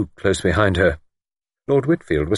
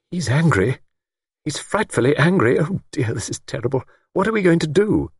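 high, anxious fretting; distressed; 9 semitones higher than usual. A narrator's reading. Older woman fears the lord's anger, distressed. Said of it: "deep distress in her voice".

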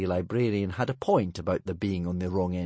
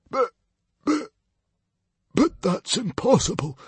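high, shocked stammer; 4 dB louder than usual. A narrator's reading. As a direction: stammer in disbelief. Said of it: "stammered the hairy, scary librarian".

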